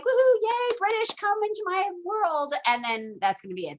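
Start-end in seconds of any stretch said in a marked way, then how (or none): 0.0-2.5 imagined colonist greeting the British in a much higher mock cheer. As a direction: squeal it like a fake delighted fan. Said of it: "you're gonna be like".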